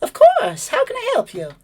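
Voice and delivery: very high, chirpy bird voice; high chirpy bird lady voice; pleasant bird lady. Acted. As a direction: chirp it like a bored bird receptionist. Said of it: "and says".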